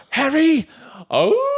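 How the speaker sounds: high name-call into a long hoot; silly, very high creature call; ridiculous Sasquatch call. Retold or imitated. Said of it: "his, like, ridiculous".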